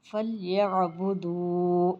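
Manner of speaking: فَلْيَعْبُدُوا slow with the final وا stretched; drawn-out recitation. Shown as a demonstration. Teacher reciting for students, vowel drawn out. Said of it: "اس طریقے سے کہیں".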